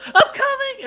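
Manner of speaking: loud falsetto I'm coming; high-pitched mock orgasm cry. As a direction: shriek it up high, hammed-up. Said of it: "and then Emily Blunt goes".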